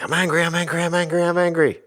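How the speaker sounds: high, loud, jumping-up-and-down angry chant; retold angry outburst. Dad venting at the stove while cooking breakfast, high, loud angry chant. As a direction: stomp and chant it like a frustrated kid. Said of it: "That was some pretty good anger, Dad".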